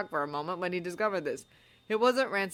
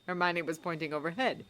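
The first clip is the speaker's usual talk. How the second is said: usual speaking voice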